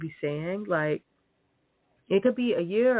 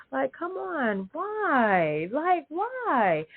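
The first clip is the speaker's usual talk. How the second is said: exasperated; 8 semitones higher, much slower than usual. Real, not acted. Exasperated rant at liars.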